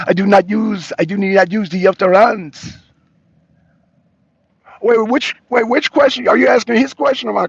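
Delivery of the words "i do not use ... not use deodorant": louder, hammed-up French accent; mock French accent. Host relaying the guest's deodorant answer, mock French accent. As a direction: quote him in a put-on French accent. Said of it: "He said he does not".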